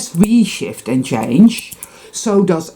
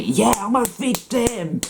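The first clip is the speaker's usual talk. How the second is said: mock victim; 5 semitones higher, 7 dB quieter, slower than usual. Mocks victim mindset.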